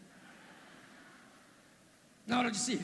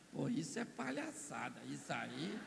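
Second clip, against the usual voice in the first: low, much softer grumble; grumbling; 5 semitones lower, 7 dB quieter than usual. Acted. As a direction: mutter your complaint about being called back. Lazarus grumbling in paradise. (Lázaro resmungando baixinho no paraíso)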